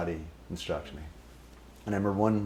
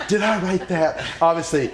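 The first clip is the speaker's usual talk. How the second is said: higher, louder mock outrage with laughter; mocking teasing; 7 semitones higher, 8 dB louder than usual. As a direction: scold him, laughing. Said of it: "he goes".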